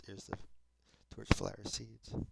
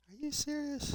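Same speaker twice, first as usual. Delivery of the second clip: much higher, squeaky disbelief; incredulous. Real, not acted. To himself on finding his buckets gone, much higher in disbelief. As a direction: shoot the pitch up in shock.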